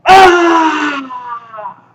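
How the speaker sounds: high loud pained yell; yell of impact. A narrator's reading. Musa taking the lion's blow, loud yell. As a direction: cry out as the lion hits. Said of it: "letting out a yell".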